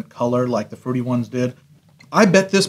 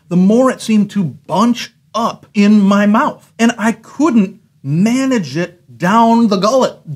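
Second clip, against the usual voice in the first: exasperated; 6 semitones higher than usual. Real, not acted. Ranting play-by-play about choking.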